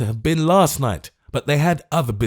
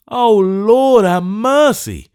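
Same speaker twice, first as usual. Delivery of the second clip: high, loud, slow comic whimper; ludicrous distressed wail; old gentleman whimpering in ludicrous distress; 12 semitones higher, 8 dB louder, much slower than usual. Acted. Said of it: "whimpered the old gentleman".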